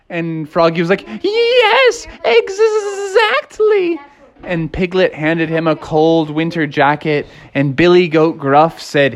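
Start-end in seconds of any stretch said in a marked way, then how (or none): 1.2-4.0 very high, stammering agreement; a small squeaky frog stuttering yes. Acted. Froggy answers Piglet, very high stutter.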